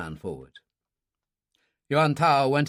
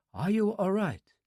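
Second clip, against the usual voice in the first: lightly voiced young woman, higher and softer; concerned young woman; 6 semitones higher, 4 dB quieter than usual. A narrator's reading. Su Yin checking on Dylan after the fight, soft and concerned. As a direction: gently ask with worry.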